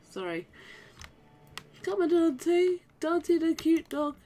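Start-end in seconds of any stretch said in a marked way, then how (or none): 1.8-4.2 high sing-out to a dog; calling a pet. Real, not acted. Player calls to cute dog Dante, high-pitched pet call. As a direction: coax the dog along in a high voice.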